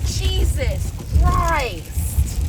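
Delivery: stunned, drawn-out gasp of alarm; shocked exclamation. Real, not acted. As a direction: blurt it out in shock. Storm hits the van.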